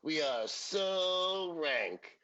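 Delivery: very slow, drawn-out sass; slow sassy drawl. Retold or imitated. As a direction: stretch every word smugly. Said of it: "Tootie was like".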